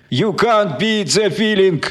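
slow, high dying moan. A narrator's reading. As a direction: moan the words out slowly. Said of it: "простонал доброволец".

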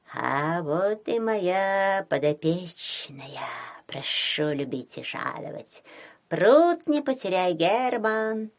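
gurgle it like a creature in water; gurgling alien. Acted. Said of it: "пробулькала из ванной щука".

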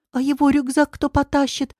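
high, squeaky question; Katya's high-pitched squeak. A narrator's reading. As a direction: pipe up in a small high voice. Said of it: "услышала она за спиной Катин писк".

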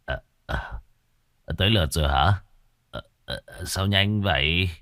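put-on slurred, hiccupping drunk; drunk slurring. A narrator's reading. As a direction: slur sleepily with hiccups. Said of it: "lè nhè vừa nói, vừa nấc cột".

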